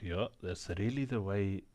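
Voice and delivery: heavy Afrikaner accent with rolled Rs. Shown as a demonstration. South African man showing his friend how Afrikaners talk, in a thick Afrikaner accent. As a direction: roll the Rs like an Afrikaner. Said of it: "South African accent with a r's".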